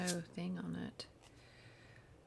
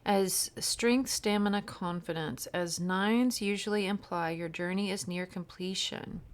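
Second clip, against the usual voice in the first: reading the card's meaning; 6 dB louder than usual. Acted. Reading card meaning aloud.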